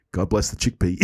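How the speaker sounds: deadpan, low and soft; distracted, understated throwaway; Clooney. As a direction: toss it off without looking up. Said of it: "In the Clooney-esque way of delivering it".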